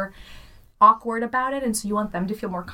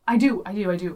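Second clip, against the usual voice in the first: ordinary talk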